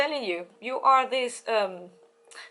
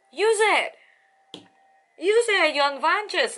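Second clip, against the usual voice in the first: higher, louder insistent push; urging; 7 semitones higher, 4 dB louder than usual. Real, not acted. Reader urging Taurus to use their charm, insistent and louder. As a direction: press it on the listener, twice over.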